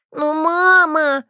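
put-on high, slow child's whine on мама; a girl refusing to give in; stubborn child. A narrator's reading. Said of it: "не унимался ребёнок". (капризный ребёнок)

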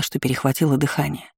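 plain reading voice

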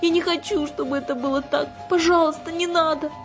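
put-on very high little-girl plea; pitiful, high child's wail; plaintive begging. A narrator's reading. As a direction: beg as if close to tears. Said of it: "жалобно воскликнула Кира".

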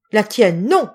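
loud, sharp refusal; crying out. A narrator's reading. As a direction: reject it with a sudden outburst. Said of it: "s'écria-t-il".

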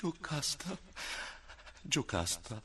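husband softly pleading with his dying wife. Acted. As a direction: call her back gently.

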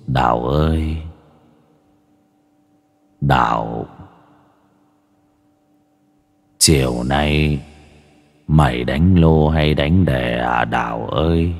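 low, slow, echoing ghost call; a ghost calling from across the river, drawn out; demonic voice. Acted. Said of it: "bật cái giọng ma quỷ lên xem nha".

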